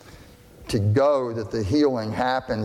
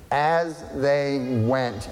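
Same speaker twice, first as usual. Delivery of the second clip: slowed for emphasis; much slower than usual. Shown as a demonstration. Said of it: "Here's really important words".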